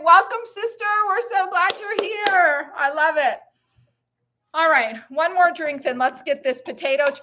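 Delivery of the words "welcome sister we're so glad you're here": excited welcome. Real, not acted. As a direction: cheer the new arrival in. Cheering a newcomer's arrival.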